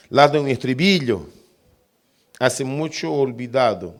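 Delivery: loud, fervent scripture reading. Acted. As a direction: proclaim the text with feeling. Reads inspired text aloud.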